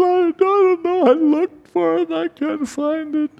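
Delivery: very high, small voice of a frightened secretary; tearful secretary. Retold or imitated. Crying secretary answering her angry boss, high and timid. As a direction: pipe up meekly, close to tears.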